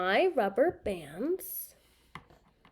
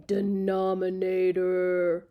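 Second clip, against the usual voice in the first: slow, drawn-out monster voice on a level pitch; playful monster voice, syllables stretched; big monster; 2 semitones lower, slower than usual. Shown as a demonstration. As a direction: intone denominator like a lumbering monster. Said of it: "big monster number".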